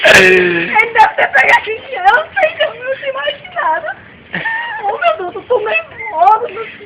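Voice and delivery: mother sobbing to the policeman about the stolen money. Acted. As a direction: wail it out like a distraught mom begging for help.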